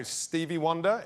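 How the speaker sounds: hesitant, questioning offer; tentative guess. Retold or imitated. Comedian guessing nervously to [name], tentative. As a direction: float it as an unsure answer.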